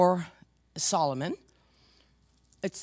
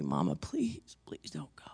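imitating a child sobbing in a hushed whisper; crying child's plea; 12 semitones lower, 8 dB quieter than usual. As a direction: whisper it like a weeping boy begging his mom. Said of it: "he's crying hysterically".

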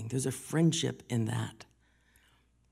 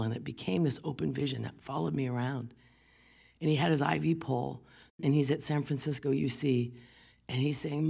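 normal talking voice

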